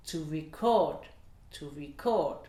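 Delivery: CORD stressed in "record", RE light; verb stress. Shown as a demonstration. Teacher demonstrates the verb, stress on CORD in "record". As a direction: hit the end: to re-CORD. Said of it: "sera sur la deuxième voyelle".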